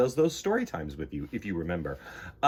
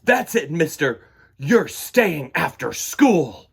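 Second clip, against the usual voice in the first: loud, slow angry scolding; fed-up teacher; 3 semitones higher, 8 dB louder, slower than usual. A narrator's reading. Teacher keeping David after school, loud and fed up. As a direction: lay down the punishment firmly.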